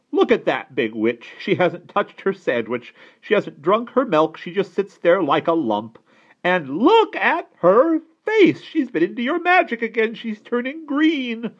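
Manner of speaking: high, loud tattling rant; accusing aunt. A narrator's reading.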